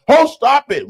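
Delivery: teasing mock outrage; mock protest. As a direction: brush it off with a laugh.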